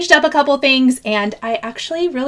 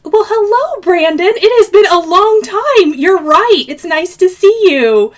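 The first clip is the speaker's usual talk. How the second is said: higher, louder delighted greeting; excited welcome; 6 semitones higher, 5 dB louder than usual. Real, not acted. Host greets returning viewer Brandon, higher and louder with delight.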